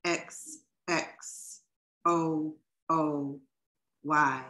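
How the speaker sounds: slow, deliberate recital with long pauses; poetry reading. Acted. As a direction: savour each image, lines spaced apart. Reads another poet's poem.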